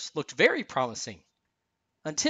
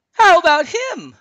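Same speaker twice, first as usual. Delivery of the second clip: loud, very high eager question; high, booming-with-eagerness snowman voice; Olaf; 13 dB louder than usual. A narrator's reading. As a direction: pipe up loudly in a high cartoon voice. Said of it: "Olaf asked".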